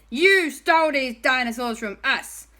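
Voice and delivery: high, loud accusation; accusing shout. Acted. Owen confronting dinosaur thieves, accusing shout.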